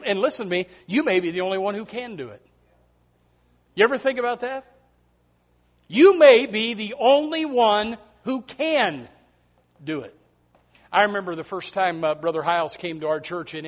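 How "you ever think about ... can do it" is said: weighty preaching. Real, not acted. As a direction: let it sink into the room.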